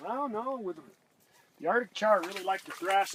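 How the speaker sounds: high, loud excited call. Real, not acted. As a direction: pitch it up with excitement. Excited over fish on screen.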